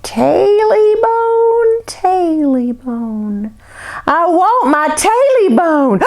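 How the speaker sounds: high, very slow ghostly wail; eerie creature voice. Acted. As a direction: stretch each word like a spirit in the dark. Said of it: "he heard a voice in the dark".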